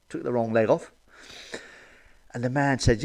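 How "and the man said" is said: normal talking voice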